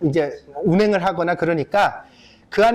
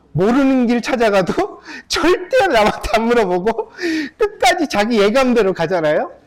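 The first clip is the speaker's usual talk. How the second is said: normal talking voice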